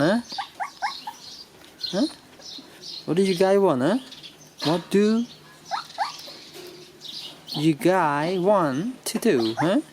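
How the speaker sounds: ordinary delivery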